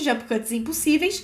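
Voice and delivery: ordinary talk, nothing marked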